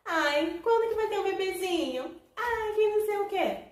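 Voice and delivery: high, loud mock nagging voice; nagging relatives. Relatives pressing the newlywed about having a baby, high mock nagging.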